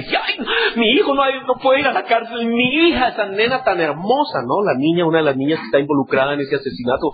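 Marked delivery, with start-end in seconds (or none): 0.0-4.4 much higher, whiny lament; mock wailing parent. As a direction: ham up the sobbing parent. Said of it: "los padres ahí".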